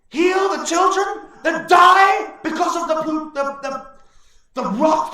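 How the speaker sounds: high, very loud, slow furious tirade; old orc railing at the chieftain; outraged elder orc shout. Acted. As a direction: hurl the words in bitter fury. Old orc's outraged speech.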